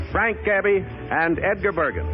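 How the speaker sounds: usual speaking voice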